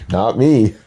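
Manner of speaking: normal talking voice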